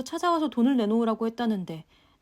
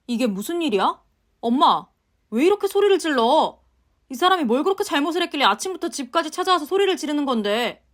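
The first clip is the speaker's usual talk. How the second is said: loud, higher confused husband; alarmed husband; 5 semitones higher, 7 dB louder than usual. Acted. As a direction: demand to know what's going on. Husband walks in on shouting. (놀라서 언성 높이는 남편)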